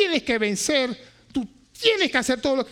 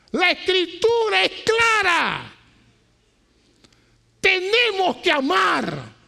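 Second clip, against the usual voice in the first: loud, high preaching to the church; full-force preaching; 7 semitones higher, 5 dB louder than usual. Real, not acted. Pastor preaching hard to his church, loud and high. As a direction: thunder it from the pulpit.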